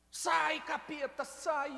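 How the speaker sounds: loud commanding cry to the demon; fierce exorcist shout; shouted rebuke. Retold or imitated. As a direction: yell it out like casting a demon out. Preacher retells casting out demon.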